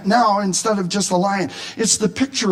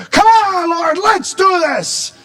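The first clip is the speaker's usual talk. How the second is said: very high, loud rallying cry; soaring battle summons; triumphant call; 9 semitones higher, 4 dB louder than usual. Acted. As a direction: urge God into the fight. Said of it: "a triumphant call to go into battle".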